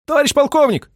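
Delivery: loud, very high-pitched cry; high alarmed call; radio operator's shout. A narrator's reading. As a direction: shout urgently for the colonel. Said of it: "закричал радист".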